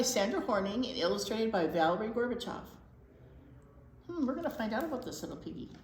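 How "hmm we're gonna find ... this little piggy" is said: usual speaking voice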